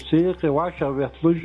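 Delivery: normal talking voice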